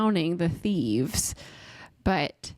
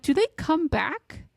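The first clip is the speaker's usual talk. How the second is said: higher, puzzled retold question; puzzled self-quote; 7 semitones higher than usual. Reader wondering aloud to her co-host, higher and puzzled. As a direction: ask it like you're confused about the plot.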